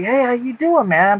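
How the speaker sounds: lightly imitated New York greeting; attempted New York accent. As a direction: raise the pitch for a rough New York hello. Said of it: "in a New York accent, I can't really replicate".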